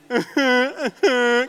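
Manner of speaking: loud, high repeated wails; hammed-up sobbing from someone flat on the floor; mock bawling. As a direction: imitate helpless, noisy crying. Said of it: "crying in the back".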